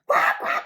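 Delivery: loud, breathless duck quacks; loud quacking. Acted. Ducklings quacking loudly at honking cars.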